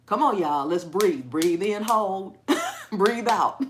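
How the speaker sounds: loud, brisk coaching with a laugh; hyper yoga instructor. Pretend. Rallying y'all to breathe, loud hyper coaching. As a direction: holler the breathing cues like a pep talk.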